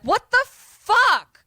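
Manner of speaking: high, loud, drawn-out outraged yell. Retold or imitated. Andy yelling at the FBI agent, high and loud. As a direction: shriek it in disbelief. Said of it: "And Andy yells".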